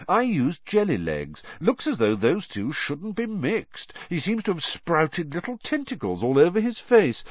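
casual observation with lifted, swooping pitch; light. A narrator's reading. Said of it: "said George lightly".

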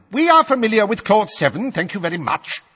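high, loud snarling official; a pompous minister snarling at the old wizard; snarled. A narrator's reading. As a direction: snap it back with contempt. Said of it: "snarled Fudge".

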